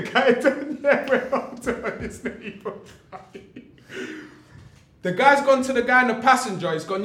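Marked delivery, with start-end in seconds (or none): 0.0-2.7 laughing through the words, slightly high; helpless laughter. Real, not acted. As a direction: tell it while cracking up. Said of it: "I shouldn't even laugh".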